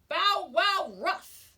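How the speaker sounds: slow, loud, high barking words; dog language. Acted. Fourth sister barks a warning at the dragon, slow and loud. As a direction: bark it out like a big dog. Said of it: "in dog language".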